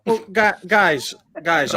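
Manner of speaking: usual speaking voice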